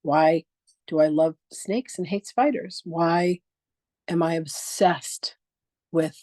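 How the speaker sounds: slow, deliberate sample questions; a teacher's slow, measured example questions. Shown as a demonstration. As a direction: lay out each example question slowly. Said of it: "like, why questions".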